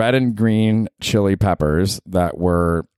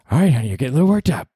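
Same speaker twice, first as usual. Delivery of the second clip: fast, higher teasing calm-down; soothing partner voice; 7 semitones higher, much faster than usual. Acted. A partner calming [name] down, quick and teasing. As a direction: play the partner calming him. Calms himself as another voice.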